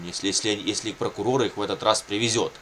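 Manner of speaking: ordinary talk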